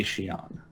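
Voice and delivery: "Xi'an" said slowly as shee-AHN; sh pronunciation. Shown as a demonstration. Panelist explains his own choice, shee-ahn. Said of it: "we pronounce it as she".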